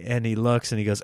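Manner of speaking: usual speaking voice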